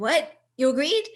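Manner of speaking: can't believe she agreed; What? You agreed? thrown back in disbelief; surprised disbelief. Retold or imitated. Shocked at cousin agreeing.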